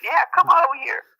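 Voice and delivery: high-pitched mock taunt; Nicole's nephew taunting. Nephew daring the border guards, taunting. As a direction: goad them to come across. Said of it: "was taunting American Border Patrol".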